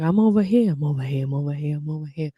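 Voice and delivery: British hypnotist voice moving around and receding; Glenn Harold. Retold or imitated. Imitating a British hypnotist moving his voice around, British accent. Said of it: "moving his voice around".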